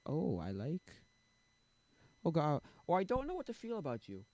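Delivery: mock agonizing over feelings; acting out hesitant crush. Speaker replaying his thoughts about women, mock torn.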